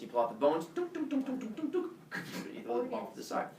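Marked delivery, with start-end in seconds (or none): 0.8-1.9 high quick tunk sound effect; bone popping noises. Acted. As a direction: make the popping sound of bones pulled out. Imitated bone-pulling noise.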